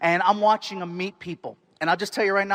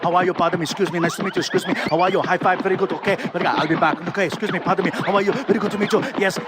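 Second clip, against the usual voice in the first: put-on rapid-fire Austrian-accented greetings; Arnold Schwarzenegger; faster than usual. Star being hurried down the line by his handler, rapid Austrian impression. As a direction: rattle off hellos like a rushed Austrian star. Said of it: "He's hitting him fast. Arnold's just like".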